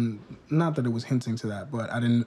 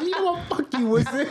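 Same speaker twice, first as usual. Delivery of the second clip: laughing impression; 13 semitones higher than usual. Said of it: "He's like".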